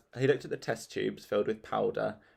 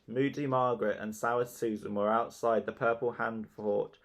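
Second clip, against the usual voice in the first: ordinary delivery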